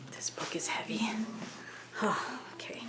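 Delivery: unvoiced whisper, breaking off from reading; a sighing whispered side remark; whispered aside. Real, not acted. Reader sighing to the listeners about the heavy book, whispered.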